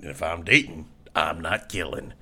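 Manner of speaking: deep mock insurance ad voice; Sam Elliott impression. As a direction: sell it low and easy like a folksy TV pitchman. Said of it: "I'm Sam Elliott for State Farm".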